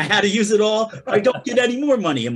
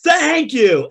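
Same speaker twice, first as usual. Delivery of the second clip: Richard Simmons; 6 semitones higher, 4 dB louder than usual. Retold or imitated. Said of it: "the man says".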